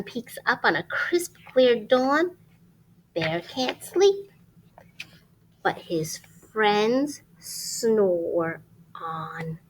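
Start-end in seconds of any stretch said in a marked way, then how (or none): 5.6-9.6 gentle ending. A narrator's reading. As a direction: let the story settle slowly.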